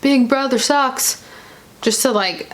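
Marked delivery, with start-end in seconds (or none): 0.0-1.2 higher, drawn-out mock jeer; jokester jeer. Vlogger imitating Devin chiming in to stir things up, teasing jeer. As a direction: imitate a joker needling the room, slowly. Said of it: "be his little jokester self".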